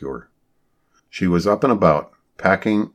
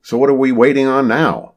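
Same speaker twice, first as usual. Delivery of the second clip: higher, louder impatient question; 7 semitones higher, 6 dB louder than usual. A narrator's reading. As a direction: push the question, clearly impatient. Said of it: "getting impatient with his impatience".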